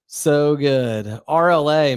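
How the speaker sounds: loud, drawn-out hype reaction; excited. Real, not acted. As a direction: savor it, loud and slow. Hyped reaction after song.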